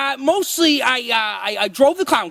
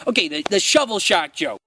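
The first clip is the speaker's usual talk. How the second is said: ordinary talk, nothing marked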